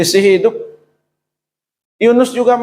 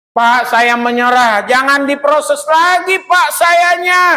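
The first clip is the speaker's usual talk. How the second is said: slow, drawn-out plea climbing much higher; crying out to God; 8 semitones higher, slower than usual. Acted. Worn-out believer begging God to stop the testing, crying out in surrender. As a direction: wail it out, stretching the words as pitch rises. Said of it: "teriak sama Tuhan".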